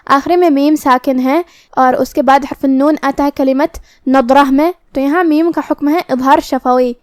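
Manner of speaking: normal talking voice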